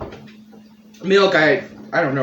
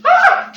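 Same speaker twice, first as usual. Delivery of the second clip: pained yelp; 9 dB louder than usual. Real, not acted. Reacting to spicy chip.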